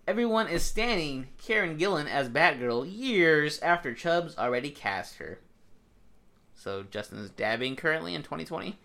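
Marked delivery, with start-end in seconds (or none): 0.1-5.4 slow, emphatic reading with YEARS drawn out; word-for-word headline read. Real, not acted. Reading co-host's headline word for word, slow emphatic read. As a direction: read it out deliberately, higher at first, stretching "years". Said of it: "I gotta read you guys what he put word for word".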